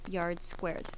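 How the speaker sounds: usual speaking voice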